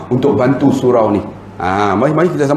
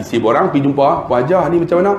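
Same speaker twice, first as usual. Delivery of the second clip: ordinary talk, nothing marked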